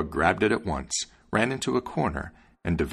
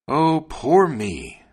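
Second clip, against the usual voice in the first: loud, high, drawn-out lament; theatrical woe, each word stretched; crying out; 7 semitones higher, 6 dB louder, much slower than usual. A narrator's reading. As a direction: wail it slowly in self-pity. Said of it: "the wagoner cried out".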